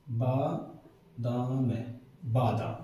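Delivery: बादाम low, soft and split into syllables; slow spelling-out. Shown as a demonstration. Teacher writing it out for students, बादाम slowly in parts. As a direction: sound out बादाम as "baa ... daam". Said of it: "तो इसे ऐसे लिखेंगे".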